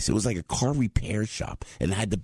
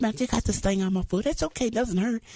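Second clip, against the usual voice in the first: a higher, breezy take on himself; lightly mock his own polite chatter, pitched up; cheery self-quote. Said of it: "I said".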